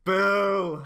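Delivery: loud booing at the pun. Real, not acted. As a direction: boo it loudly.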